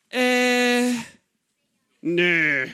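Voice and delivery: a drawn-out hesitant "äh", then a flat dismissive "nö"; mock-reluctant shrug of a no; reluctant refusal. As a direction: brush God off like a sulky teenager. Moses refusing God's call.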